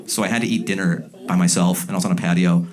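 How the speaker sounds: normal talking voice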